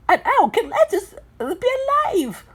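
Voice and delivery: high-pitched character retort. A narrator's reading. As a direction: throw the question back in a high voice. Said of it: "Three retorted".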